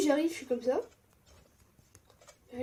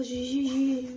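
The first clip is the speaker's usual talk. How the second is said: usual speaking voice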